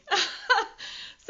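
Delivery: bright burst of real laughter; a real embarrassed laugh. Real, not acted. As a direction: laugh it off at yourself. Laughs at herself.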